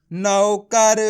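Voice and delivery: नौकर joined, loud and stretched; blended syllables. Shown as a demonstration. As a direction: join नौ-क-र into one emphatic word. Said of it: "तो मिलके बन जाएगा".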